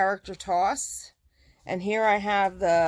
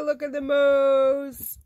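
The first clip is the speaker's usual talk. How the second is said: high, slow delighted coo; cooing over a cute moose, high and slow; 8 semitones higher, slower than usual. Real, not acted. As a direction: gush over the moose like it's adorable. Gushing over cute moose print.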